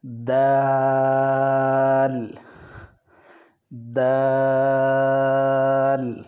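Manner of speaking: دال stretched long and sung out evenly; drawn-out letter recitation. Shown as a demonstration. Teacher models دال to students, long and chanted. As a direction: recite دال slowly like a Qaida drill. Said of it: "تو چلیں آج کا سبق ہم پڑھیں گے".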